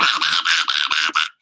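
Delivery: low, rapid repeated quacking; mimicked duck racket; imitated crazy duck. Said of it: "he would turn right back around".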